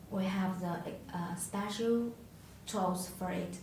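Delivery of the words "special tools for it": ordinary delivery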